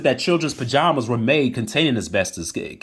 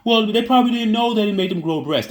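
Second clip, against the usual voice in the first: mocking normal people; 8 semitones higher than usual. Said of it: "this is what normal people would say".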